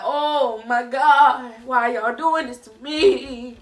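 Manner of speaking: loud, drawn-out lament; mock wail. Viewer to the show over heartbreak, mock wail. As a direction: wail it slowly in playful despair.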